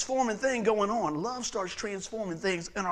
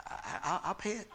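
mock sheepish; 10 dB quieter than usual. Sheepish reply about having no money.

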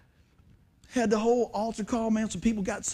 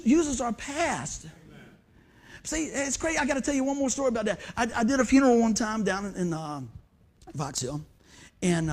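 usual speaking voice

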